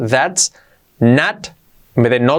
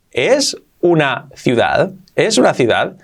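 ordinary delivery

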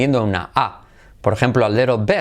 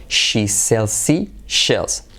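"She sells seashells" stretched out very slowly; slow tongue twister; 2 semitones lower, much slower than usual. Shown as a demonstration. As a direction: drill each sound slowly and clearly. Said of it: "Por lo tanto".

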